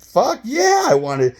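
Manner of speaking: high, loud burst of eagerness; eager yes. Retold or imitated. Answering the friend's offer to drive a train, loud and high. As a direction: shout it like a thrilled kid.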